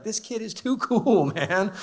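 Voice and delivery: a hint of jazz-cat cool; amused mock cool. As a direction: play it as a laid-back, impressed dad. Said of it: "And I'd be like".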